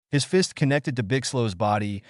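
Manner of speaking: ordinary delivery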